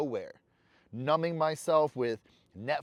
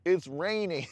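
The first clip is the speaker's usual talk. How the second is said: mock whining; 4 semitones higher, slower than usual. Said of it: "just getting on myself like".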